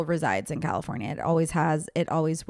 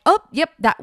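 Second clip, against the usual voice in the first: higher, louder caught-out "Oh, yep"; sheepish startled admission; mock sheepish surprise; 9 semitones higher, 7 dB louder than usual. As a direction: realise you've blurted something odd. Caught saying something weird.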